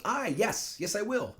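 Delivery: put-on high-pitched, eager little-girl agreement; little child Juliet. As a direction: pipe up like a small child saying yes. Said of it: "she stops crying, goes".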